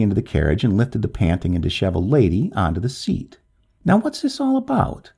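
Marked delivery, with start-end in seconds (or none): 3.8-5.1 high-pitched brusque questioning; narrator's understated gruff official; somewhat rough. A narrator's reading. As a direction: demand an explanation, mildly roughly. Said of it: "he asked somewhat roughly".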